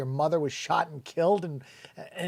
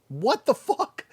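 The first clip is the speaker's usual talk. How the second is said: higher, incredulous what the fuck; baffled outburst; real disbelief; 9 semitones higher, 4 dB louder, slower than usual. Real, not acted. Incredulous reaction again.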